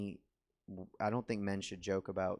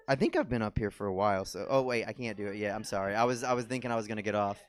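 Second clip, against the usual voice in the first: usual speaking voice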